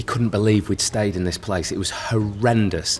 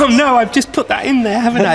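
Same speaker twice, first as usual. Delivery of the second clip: much higher, louder showbiz host voice; Bruce Forsyth impression; 5 dB louder than usual. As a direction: camp old TV host voice. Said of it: "Bruce Forsyth impressions".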